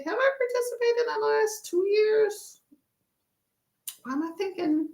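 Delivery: high, soft pondering voice; puzzled, high-pitched musing to oneself; wondering aloud. Real, not acted. Wonders aloud, trying to remember.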